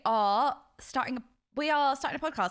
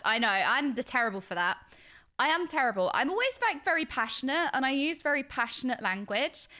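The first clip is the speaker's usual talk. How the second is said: normal talking voice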